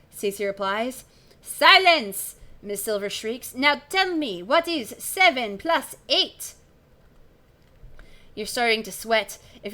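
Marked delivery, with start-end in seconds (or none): 1.4-2.3 loud, high French command; French accent. Acted. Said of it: "She's French as well". 3.5-6.5 slow, loud French quizzing; slow French-accented teacher's question. Acted. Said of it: "She's French as well".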